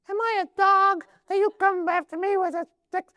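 very high, squeaky comic giant; silly high falsetto taunt. Acted. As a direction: squeak it out as an absurdly high-pitched Philistine. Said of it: "maybe he had a squeaky voice".

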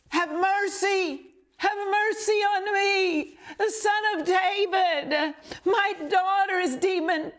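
high, pleading cries building up; a mother crying out for her sick daughter, desperate high pleading. Retold or imitated. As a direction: beg over and over, desperate. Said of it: "Can't you just hear her?".

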